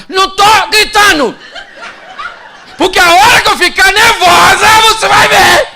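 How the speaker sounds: high, screaming wife in denial; full-on yelling. Retold or imitated. Wife answers husband's shushing, screaming nervously. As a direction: yell it at the top of your voice, mock-furious. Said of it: "Ela sabe que tá gritando, sim ou não?".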